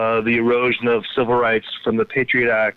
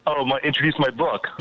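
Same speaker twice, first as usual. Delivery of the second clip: ordinary talk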